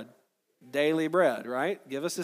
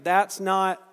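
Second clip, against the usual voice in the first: louder prompt; 3 semitones higher, 6 dB louder than usual. Shown as a demonstration. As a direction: push That's not harder the second time round. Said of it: "Try it again".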